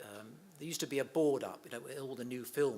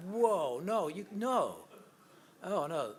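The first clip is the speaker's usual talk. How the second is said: horrified no; 6 semitones higher, 7 dB louder, slower than usual. Retold or imitated. Producers horrified at pratfall idea.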